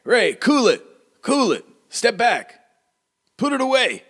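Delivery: high-pitched, urgent small-town mayor; rattled mayor calling off his guard. Acted. Mayor telling Ray to cool it, high and urgent. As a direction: repeat the orders in a high, urgent character voice. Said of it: "Okay, he's like".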